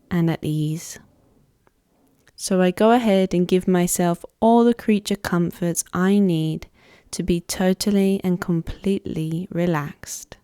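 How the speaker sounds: measured Yoga Nidra voice with long pauses; guided meditation. Acted. Yoga teacher guiding listeners to let go of tension, guided meditation voice. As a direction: lead a relaxation unhurriedly, phrase by phrase. Pre-recorded Yoga Nidra practice led in first person.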